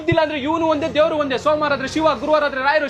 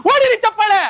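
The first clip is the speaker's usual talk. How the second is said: calling for applause; 6 semitones higher, 5 dB louder, slower than usual. Acted. Bar owner rallies crowd to clap.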